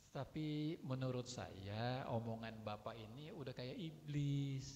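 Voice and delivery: slow, falsely gentle insult; soft pressed tone over buried anger; dilembut-lembutin. Acted. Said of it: "Walaupun dilembut-lembutin". (pelan tapi ditekan, pura-pura lembut)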